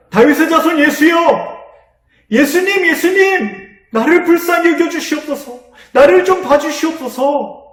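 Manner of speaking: the blind man crying out again over the crowd, desperate and loud. Acted. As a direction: shout it out with everything you have. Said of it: "더 큰 소리로 외쳤어요".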